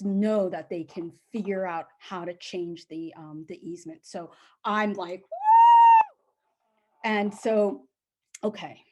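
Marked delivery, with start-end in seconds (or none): 5.2-6.1 long, high, loud woo; celebratory whoop. Retold or imitated. Seller relieved the lawyers are buying, whooping. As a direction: whoop at the top of your voice. Her cheer at the good news.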